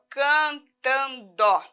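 cantando said very slowly; slow, drawn out. Shown as a demonstration. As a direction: stretch out cantando for the children. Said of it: "trabalhar essa palavra".

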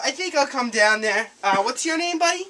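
ordinary talk

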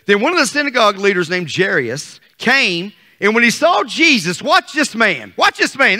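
ordinary delivery

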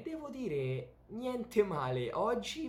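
high, slow, amazed praise; impressed exclamation. Real, not acted. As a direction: savour the compliment. (stupore compiaciuto)

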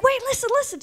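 high, pleading call after her; eager teen. Retold or imitated. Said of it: "And I was like".

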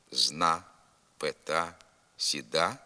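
зна, пета, сіда cut off on the stressed final "a"; dropped є ending. Shown as a demonstration. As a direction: end зна, сіда on open "a", no "ye". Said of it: "без закінчення «є»".

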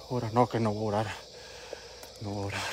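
very low, soft murmur; hushed. Real, not acted. Ghost hunter to himself about praying, hushed. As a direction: keep it down, low and under the breath. Hushed fear near the spirit.

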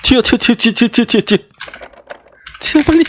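frantic urging. Real, not acted. Fleeing the witch.

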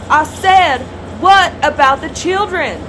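shouting over the noise. Acted. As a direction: raise your voice to be heard. Reporter repeats over noise.

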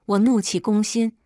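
usual speaking voice

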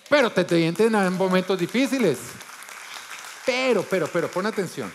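loud, high urgent call to attention; fervent preaching. Real, not acted. Preacher urging the congregation, loud and high. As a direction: hit it hard to wake the room.

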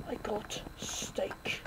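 breathy unvoiced repeat, slow; whisper. Shown as a demonstration. Gamer repeating I got steak as a whisper. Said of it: "whispered repeat".

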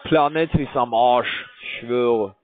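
ordinary delivery

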